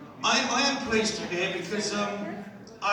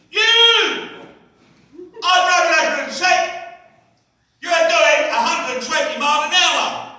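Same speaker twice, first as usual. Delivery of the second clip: bellowing as if I'm deaf, much higher and louder; hammed-up traffic cop roaring at a driver; mock cop shouting; 9 semitones higher, 8 dB louder than usual. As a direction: yell every word like a cop who thinks you can't hear. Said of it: "traffic cops think we're all deaf".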